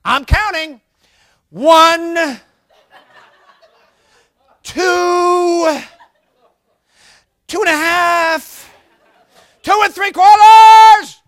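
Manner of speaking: a parent counting down and stalling before the last number; mock counting parent. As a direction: stretch the count out in a comic growing yell. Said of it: "How about this one?".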